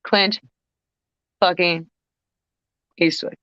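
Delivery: each word set apart by long pauses; awed dramatic reveal. Real, not acted.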